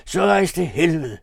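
high, loud furious outburst; angry shout; screamed curse. A narrator's reading. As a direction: hurl the curse at full voice. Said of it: "skreg Arthur".

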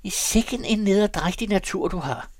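high, loud exclamation; troubled outburst. A narrator's reading. Valse Peter exclaims at his companion, troubled and loud. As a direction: burst out with pitch right up. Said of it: "udbrød Valse Peter bekymret".

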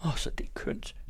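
smiling. A narrator's reading. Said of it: "sagde Ellen og smilede".

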